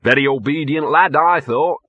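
self-satisfied character voice; smug. A narrator's reading. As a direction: sound pleased with your own cleverness. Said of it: "said Gaspode smugly".

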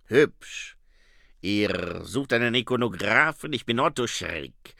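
put-on accented vampire character voice; Otto Schreck. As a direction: introduce yourself as a theatrical vampire. Vampire introduces himself in story. (Vampirstimme mit Akzent)

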